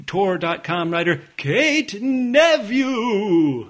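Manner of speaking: slow, drawn-out hype man call; a hint of a hype man; hype man intro. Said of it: "He introduces you like this".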